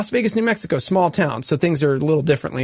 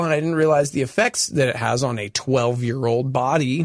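normal talking voice

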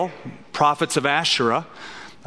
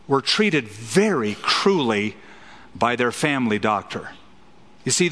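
normal talking voice

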